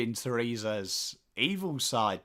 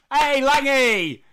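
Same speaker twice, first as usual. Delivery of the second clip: high, loud, drawn-out greeting; calling out; 10 semitones higher, 8 dB louder, slower than usual. Real, not acted. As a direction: holler hello to a mate.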